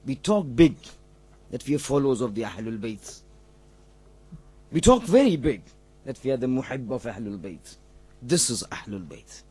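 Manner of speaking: very low, soft voice, nearly spent; hushed, solemn preaching. Real, not acted. Preacher reproaching his own congregation after the story, hushed and solemn. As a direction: drop right down into a grave, subdued reflection.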